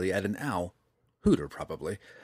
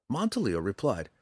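ordinary delivery

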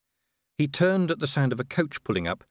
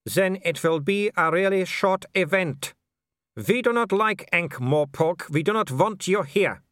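put-on higher, Germanic-accented villain; menacing werewolf leader; 9 semitones higher, 3 dB louder than usual. Werewolf tells the unwelcome outsider the rules, menacing. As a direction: warn him off in a foreign villain's voice.

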